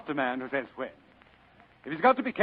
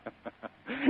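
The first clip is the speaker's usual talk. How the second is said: quick, soft, higher chuckle; a delighted little laugh; amused chuckle; 9 semitones higher, 12 dB quieter than usual. Acted. Gutman laughs at Spade's reply.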